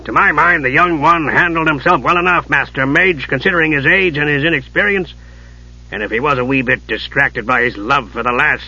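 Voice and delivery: gruff dwarf, lilting and argumentative; indignant dwarf defending a young friend; growling dwarf Dugan. Acted. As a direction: argue back stoutly as a dwarf. Narrator voices Dugan defending Palin.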